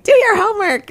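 high, loud nagging shriek; shrill fishwife. Acted. Mother nagging her children about homework, shrill fishwife voice. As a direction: screech it out like a scolding fishwife. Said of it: "you can use that shrill fishwife voice".

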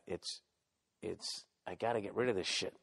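usual speaking voice

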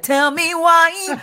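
high slow plea. Pretend. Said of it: "be like".

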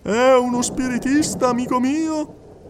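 high, insistent clairvoyant addressing the hall; a medium coaxing a silent widow; medium's voice. Acted. Medium speaking to the crowded séance hall, high and insistent.

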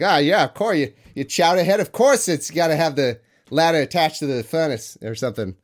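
put-on playful New England Boston accent. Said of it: "that was a respectable Boston accent there".

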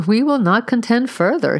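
amused, with a light laugh; smiling. Acted. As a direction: say it through a smile. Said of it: "he smiled".

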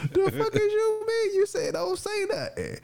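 very high, squealed mock protest; incredulous protest. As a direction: squeal it out in bewildered disbelief. His baffled comeback to her.

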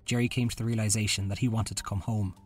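ordinary delivery

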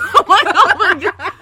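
normal talking voice

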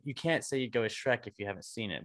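ordinary delivery

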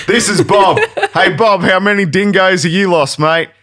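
loud, high broad Australian ad voice; full Australian. Acted. Mock weight-loss testimonial with Bob, full-on Australian. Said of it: "We went full Australian there".

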